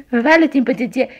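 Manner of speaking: ordinary delivery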